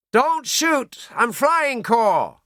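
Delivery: high, loud desperate shout; shouted plea. A narrator's reading. Downed pilot shouting to a patrol, loud and high. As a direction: yell it to the soldiers so they hold fire. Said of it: "Kelso shouted".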